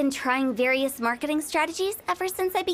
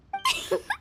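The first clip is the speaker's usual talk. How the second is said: high, delighted giggle; pleased little cackle; gleeful cackle. Acted. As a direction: cackle at the clever slogan. Gleeful laugh at slogan.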